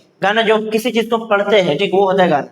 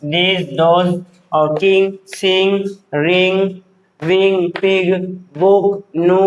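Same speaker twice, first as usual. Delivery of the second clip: each word on its own with pauses; measured teacher's read-aloud, level pitch; word-list drill; much slower than usual. Shown as a demonstration.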